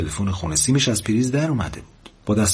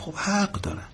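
soft, high-pitched murmur; muttering to herself; 11 semitones higher, 8 dB quieter than usual. A narrator's reading. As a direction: say it under the breath, resigned. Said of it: "زیر لب گفت".

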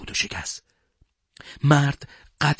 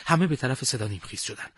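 plain reading voice

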